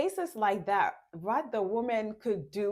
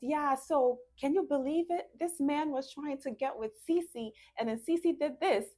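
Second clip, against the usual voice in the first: gossiping woman; 7 semitones higher than usual. Acted. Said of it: "the woman is saying".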